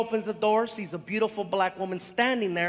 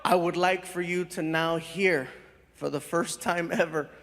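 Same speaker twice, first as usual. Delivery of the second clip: showman announcer; 5 semitones lower than usual. Acted. Host presenting his friend to the crowd, grand announcer build-up. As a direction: hype the guest like a host. Formal stage introduction.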